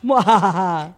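sneering panto villain laugh; evil cackle. Pretend. Evil laugh after watching.

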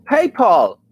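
PayPal copied as peipol, o-like last vowel; imitating the translator. Shown as a demonstration. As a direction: echo the machine's PayPal. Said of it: "Es como una A o PayPal". (imitando al traductor: peipol)

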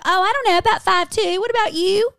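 high, loud mock mom answering the valet; mom's voice. A daughter voicing her mom's chirpy reply to the valet.

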